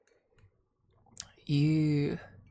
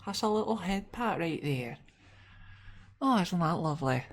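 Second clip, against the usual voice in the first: tender coo; 6 semitones higher than usual. Acted. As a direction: coo over the little head pat. Cooing at head pat.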